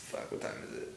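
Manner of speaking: breathy whispered aside. Real, not acted. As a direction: mutter it under your breath. Muttering to himself, just woke.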